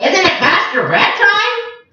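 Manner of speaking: high teasing parrot voice. Acted. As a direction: tease them as the chuckling parrot. Papagayo teasing the creatures.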